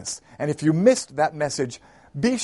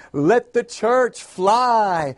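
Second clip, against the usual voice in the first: raised, drawn-out rallying cry; pastor shouting; 5 semitones higher, 4 dB louder, much slower than usual. A narrator's reading. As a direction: rouse the congregation with a long, high call. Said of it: "the pastor started shouting again".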